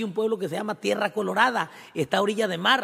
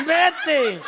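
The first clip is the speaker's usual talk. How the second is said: shooing shout; 10 semitones higher, 5 dB louder than usual. Retold or imitated. Owner shooing sellers away.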